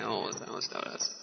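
whisper. Real, not acted. Dramatic aside about the killing.

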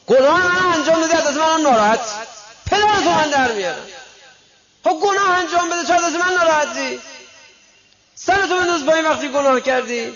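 very high, loud, booming rebuke; scolding divine voice. Retold or imitated. Preacher voicing God rebuking the complaining sinner, booming scold. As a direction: thunder down at the sinner.

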